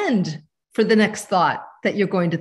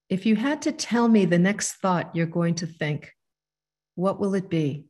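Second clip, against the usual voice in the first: calm instruction to close the eyes; guided meditation; 3 semitones lower than usual. Acted. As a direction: ease them into stillness, unhurried. Leads a short guided meditation.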